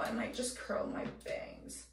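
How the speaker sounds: ordinary delivery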